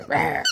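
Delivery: low growl. Pretend. Cheering baby on, playful growl.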